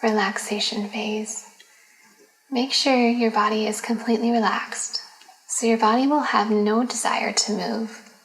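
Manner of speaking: guided meditation. Acted. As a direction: lead them gently into relaxation, phrase by phrase. Leads a yoga nidra.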